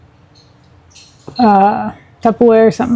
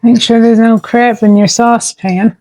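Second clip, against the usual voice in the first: ordinary talk, nothing marked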